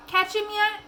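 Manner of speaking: mild call across the yard; half-called question from the door. A narrator's reading. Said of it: "call out".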